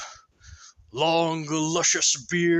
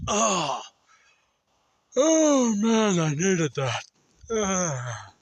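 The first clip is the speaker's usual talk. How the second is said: higher, louder satisfied groan of relief; a man cracking his neck after sleep; stretching relief; 4 semitones higher, 4 dB louder, slower than usual. Acted. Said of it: "pops his neck and goes".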